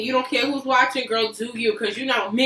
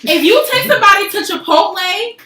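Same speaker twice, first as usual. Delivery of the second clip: calling foul; 4 semitones higher, 7 dB louder than usual. Real, not acted.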